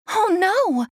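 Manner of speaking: high, alarmed cry of oh no. A narrator's reading. Ariel crying out as the pearl sinks, alarmed. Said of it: "Ariel shouted".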